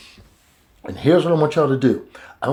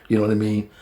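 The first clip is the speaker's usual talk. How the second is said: normal talking voice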